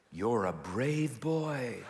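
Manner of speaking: slow and gentle, building him up; dad slowly reassuring his scared son; encouraging dad. Retold or imitated. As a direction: coax a scared little boy, slowly.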